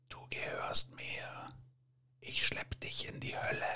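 husky, seductive devil's whisper; smoky whisper. A narrator's reading. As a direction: breathe it low and menacing. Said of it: "flüsterte mit rauchiger Stimme". (rauchiges, verführerisches Flüstern)

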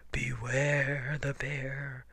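slow, drawn-out growly bear voice; spooky bear call, very slow. A narrator's reading. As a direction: drag out the warning in a growl. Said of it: "a great growly voice called out".

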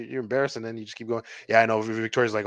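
usual speaking voice

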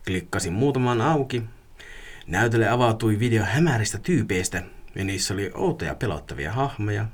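measured, subdued read-aloud with pauses; creepy story narration. A narrator's reading. Reading a listener's dark web story, creepy narration. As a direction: unfold an eerie tale unhurriedly.

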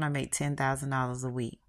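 plain reading voice

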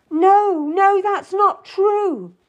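very high, loud, distressed denial; crying out. A narrator's reading. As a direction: wail the denial in protest. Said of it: "I cried out".

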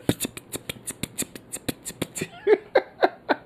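silent high wheezing laugh; breathy wheeze laugh. Real, not acted. Laughing after outro joke.

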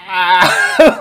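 high, loud strangled ah; imitating someone being choked; mock choked gasp. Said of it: "she's like".

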